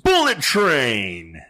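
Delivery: drawn-out, loud hype call; slow and loud, like a movie title; excited announcement. Real, not acted. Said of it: "I don't know why I did that motion".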